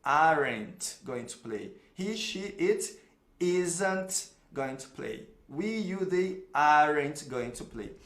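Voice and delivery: slow, careful contracted negatives; slow teaching model. Shown as a demonstration. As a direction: read each form slowly for the class. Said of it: "acompanha o mouse aí". (leitura lenta de professor)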